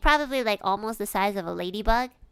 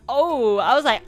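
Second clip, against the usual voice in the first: retold dismay; 5 semitones higher, 9 dB louder than usual. Reacts to squashed bug.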